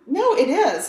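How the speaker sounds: ordinary talk, nothing marked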